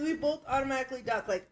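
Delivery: ordinary talk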